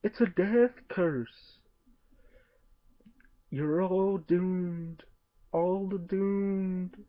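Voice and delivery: slow, high ominous warning; slow ominous raspy drawl. Retold or imitated. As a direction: drag it out like a doomsayer. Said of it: "he's always going like".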